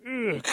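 high, loud wincing cry; horrified "ugh". Acted. Rabbit watching Possum get squashed, wincing aloud. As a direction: recoil at the squashing.

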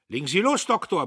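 high, loud military order; major's brisk command. A narrator's reading. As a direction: order him to begin. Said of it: "befahl der Major".

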